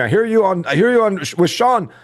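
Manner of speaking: high, badly faked French accent; hammed-up, unconvincing French accent; terrible French accent. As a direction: imitate an excited French Canadian, pitched well up. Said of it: "that was a terrible French accent".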